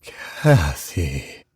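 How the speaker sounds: low, drawn ghost voice; wail sliding to whisper. Acted. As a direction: let the wail sink to a dry whisper. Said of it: "sliding down from a wail".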